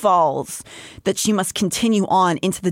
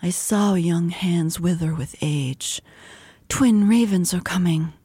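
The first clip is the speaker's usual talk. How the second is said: eerie prophetic whisper. Acted. Prophetic Elaine quote.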